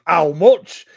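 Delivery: gruff Yorkshire "How much?"; broad Yorkshire, loud; Yorkshire accent. Retold or imitated. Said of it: "in a Yorkshire accent".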